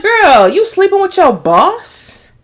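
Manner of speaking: a mock-scandalized friend; incredulous. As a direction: draw it out like juicy gossip. Host reacts to the show.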